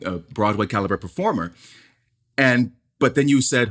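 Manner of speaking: usual speaking voice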